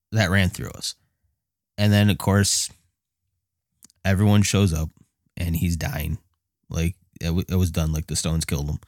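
ordinary talk, nothing marked

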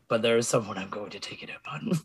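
soft, breathy vow of revenge; menacing whisper. Acted. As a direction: whisper the threat. Quiet threat before shout.

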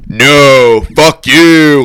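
very loud, punched retort; angry shout back. Retold or imitated. Singer answering a heckler mid-song, angry shout.

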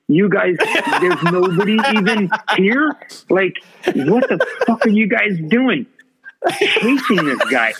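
high, laughing mock outrage; incredulous, cracking up; laughing disbelief. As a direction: laugh through your baffled rant at the fans. Incredulous rant at the crowd, laughing.

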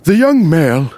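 a hint of hushed nature narration; lightly voiced documentary narrator; David Attenborough. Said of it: "David Attenborough just like".

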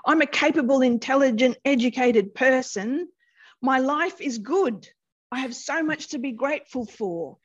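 acted exasperation. Therapist acting out a client baffled by anxiety, exasperated. Voices a frustrated anxious client.

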